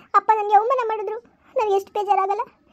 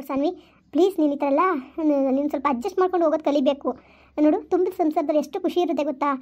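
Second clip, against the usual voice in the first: put-on calm a crying child gently; mother consoling her weeping daughter; soothing mother; 5 semitones lower than usual.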